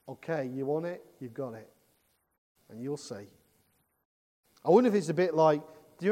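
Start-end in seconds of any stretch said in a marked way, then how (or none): 0.1-3.3 softer, lower ominous warning; God's quiet threat. Acted. God answering defiance, lower and softer. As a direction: let the threat sink in quietly. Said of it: "God says".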